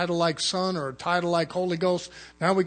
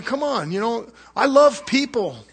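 higher and louder, stretched-out pleading; earnest, raised plea to God; pleading with the Lord; 6 semitones higher, 6 dB louder than usual. Retold or imitated. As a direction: beg the Lord with your whole heart. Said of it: "I'm thinking".

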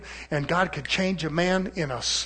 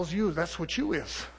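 normal talking voice

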